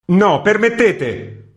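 slow, loud and high insistent no; raising his voice. A narrator's reading. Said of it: "alzando la voce".

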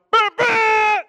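two very loud, high horn blasts; imitated angry honking. Driver honking back after a warning, imitated horn blasts. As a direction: blare back like a car horn. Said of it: "I respond".

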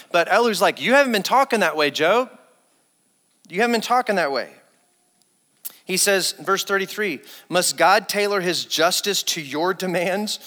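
booming sermon delivery of a rebuke; fervent preaching. Acted. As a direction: preach Elihu's words at full force. Impassioned scripture quote.

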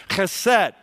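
chesed, rasping ch like Scottish loch, stress first syllable; Hebrew word chesed. Shown as a demonstration. Teaching the class his Hebrew word, clear throaty chesed. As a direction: say chesed with a throaty kh at the start, KHEH-sed. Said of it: "here's your second Hebrew word of the day".

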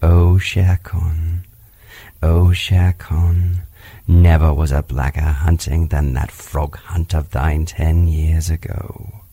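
low, slow, grim panther voice; a big cat growling out an old grudge; brooding panther. Acted. Bagheera broods on Shere Khan.

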